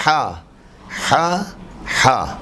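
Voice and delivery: "ہا" with the Arabic pharyngeal h; mid-throat ha. Shown as a demonstration. Teacher drills the throat letter, pharyngeal ha. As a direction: push "ہا" out from the middle of the throat. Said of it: "وسط حلق سے ادا ہونے والے".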